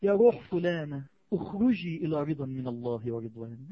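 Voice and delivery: low, slow, gentle summons; tender angel voice. Acted. Angel of Death calling a believer's soul, tender and slow. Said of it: "يجي جنب دماغك بحنية ويقول لك".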